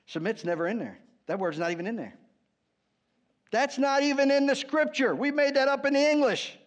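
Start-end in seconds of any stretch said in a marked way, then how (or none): none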